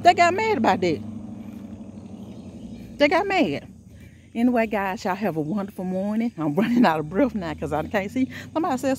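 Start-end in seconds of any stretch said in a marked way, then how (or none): 0.0-3.7 high, fervent preaching voice. Real, not acted. Preaching to her viewers about Jesus and haters, fervent sermon.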